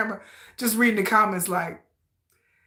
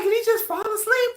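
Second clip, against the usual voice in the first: surprised; 11 semitones higher than usual. Retold or imitated. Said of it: "I was like".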